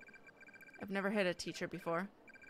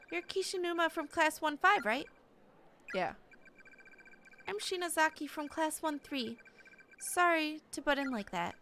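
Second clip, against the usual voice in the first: high-pitched, tearful-schoolgirl character; high, polite schoolgirl voice; apologetic class rep; 8 semitones higher than usual. Acted. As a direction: explain yourself sheepishly in a high girlish voice. Class rep apologizes, introduces herself.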